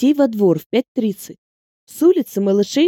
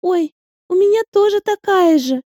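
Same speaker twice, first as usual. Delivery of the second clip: put-on high, chatty little girl; a small girl showing off; 9 semitones higher than usual. A narrator's reading. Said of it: "объявила одна из них".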